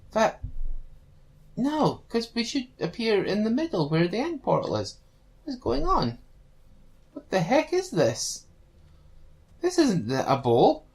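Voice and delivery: rising alarm, high pitched; bewildered, voice climbing; confused. Pretend. Confused panic at strange world.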